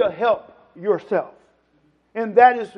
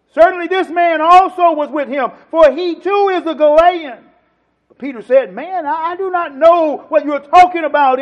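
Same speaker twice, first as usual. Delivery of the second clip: loud, raised pulpit declamation; emphatic preacher reading out dialogue; forceful scripture reading; 7 semitones higher, 12 dB louder than usual. Real, not acted. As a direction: declaim the accusation and denial to the congregation.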